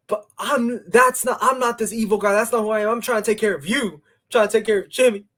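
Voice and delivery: high, hurried pleading in imitation; Roman Reigns. Acts Roman pleading with his cousin.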